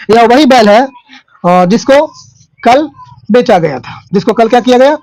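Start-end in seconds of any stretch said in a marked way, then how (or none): none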